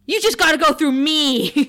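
high and loud, hammed-up defiance; dramatic. Buffy daring Tara's dad, hammed-up loud challenge. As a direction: throw down the challenge theatrically. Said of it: "it's so dramatic".

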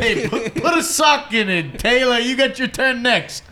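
loud shout up high, slowed a bit. Pretend. Host shouting at Taylor to let the guest talk, loud. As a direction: bark at him to pipe down.

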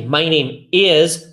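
slow, word by word "My name is"; slow and careful. Shown as a demonstration. Teacher models the slow textbook form, slow and careful. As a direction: say "My name is" slowly, each word apart. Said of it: "Você vai falar um pouco mais rápido".

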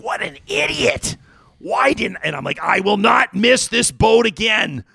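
high, slow self-scolding inner voice; self-reproach. Retold or imitated. Host reliving his missed investment, scolding himself high and slow. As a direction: scold yourself for missing out. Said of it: "with a pit in my stomach".

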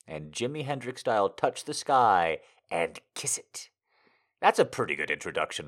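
normal talking voice

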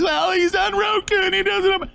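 squeaky mocking voice of an excuse maker; mocking falsetto whine; mock whiny excuse. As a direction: sneer out the lame excuse.

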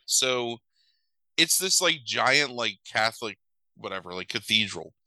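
usual speaking voice

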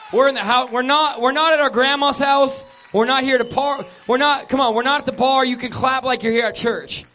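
loud, high, driving church exhortation; full-force preaching. Acted. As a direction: rouse the crowd to clap like in church.

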